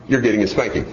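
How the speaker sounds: ordinary talk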